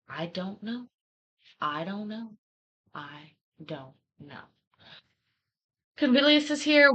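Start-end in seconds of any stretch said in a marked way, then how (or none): 0.1-4.4 fading mantra. Shown as a demonstration. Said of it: "the mantra is".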